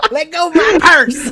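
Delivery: high, loud cartoon boy shout; imitated squealing boy; Bobby. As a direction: shriek it like a cartoon kid in self-defense. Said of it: "he's like".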